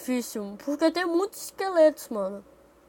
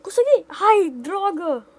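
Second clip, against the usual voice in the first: excited shout; 5 semitones higher, 7 dB louder, slower than usual. Real, not acted. Success then failure.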